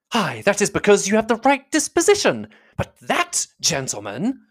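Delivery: high-pitched, fussy mother; affronted matron. A narrator's reading. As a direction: praise one man, then single out the other. Mrs Bennet contrasts Bingley and Darcy.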